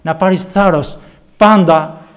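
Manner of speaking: rally them boldly, don't be afraid; loud, fervent call to take courage; preaching. Acted. Encouraging believers not to fear.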